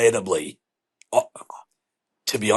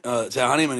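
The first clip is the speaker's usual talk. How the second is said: usual speaking voice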